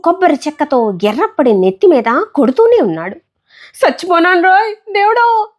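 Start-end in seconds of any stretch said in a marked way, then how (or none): 3.8-5.5 high wailing scream; death wail. Acted. Boy beaten on the head, crying out to God in a high wail. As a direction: wail as if dying. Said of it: "చావు కేకలు పెడుతున్నాడు".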